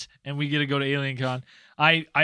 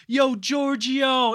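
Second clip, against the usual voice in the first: calling out; 7 semitones higher, much slower than usual. Retold or imitated. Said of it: "It was more like".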